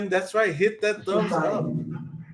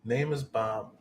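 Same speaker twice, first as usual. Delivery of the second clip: voice through static; 6 semitones lower, 5 dB quieter than usual. Real, not acted. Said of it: "my spirit box said".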